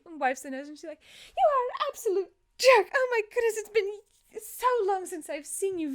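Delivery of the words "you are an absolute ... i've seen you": high, loud scolding that softens; acted high-pitched emotional gush; exasperated affection. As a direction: berate a long-missed friend, overjoyed. Jin scolds long-lost friend.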